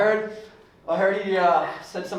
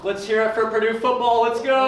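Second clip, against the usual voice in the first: loud, high cheer; 8 semitones higher, 6 dB louder than usual. Real, not acted. Hyping the audience for Purdue football, loud cheer. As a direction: whip up the crowd.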